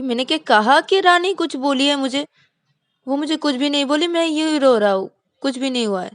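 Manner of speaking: high, loud defensive protest; defensive denial. Acted. Vikas denying to friends that Rani hurt him, high and defensive. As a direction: insist nothing happened, too hard.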